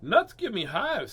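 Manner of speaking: high, loud rabbit reply; storybook rabbit. A narrator's reading. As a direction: answer as a rabbit, drawn out and emphatic. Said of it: "said Rabbit".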